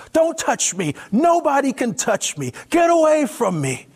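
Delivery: high, loud panicked yelling; desperate screaming at the stranger; frantic shouting. Acted. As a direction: push him away with your voice, terrified. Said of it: "fumbling frantically with the door handle".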